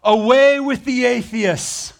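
slow defiant retort, pitched high; defiant reply. Retold or imitated. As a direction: turn the accusation back on them, slowly. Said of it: "He says".

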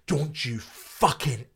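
slow, seething threat in the face; manager yelling. Retold or imitated. Manager berating the comic backstage, slow menacing threat. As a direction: jab each word like a furious manager. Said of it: "like, in my face, finger".

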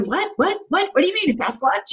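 high, fast, incredulous imitation; mock baffled adults. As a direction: rattle off the whats like puzzled grown-ups. Adults' disbelief voiced in story.

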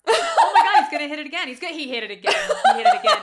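loud, high, laughing panic; panicked yell. Retold or imitated. Uncle yelling as grandpa hits the car, panicked. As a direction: shriek it as the car gets hit again. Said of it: "my uncle goes".

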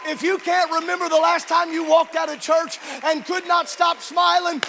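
rousing sermon climax; fiery preaching. Acted. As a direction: preach it out to the whole church.

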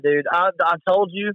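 ordinary talk, nothing marked

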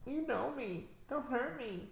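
high character voice. Pretend.

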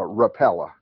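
"Rapala" said as ruh-PELL-uh; imitated pronunciation. Asking the hosts who said it, "Rapala" as ruh-PELL-uh. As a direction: give "Rapala" an "ell" in the middle. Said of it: "which one of you said".

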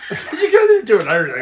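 mock a mate through laughter; laughing tease. Real, not acted. Teases a failed impression, laughing.

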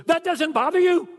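can't believe what he's hearing; incredulous son. Retold or imitated. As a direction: son asking his father in disbelief, high and loud. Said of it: "He goes".

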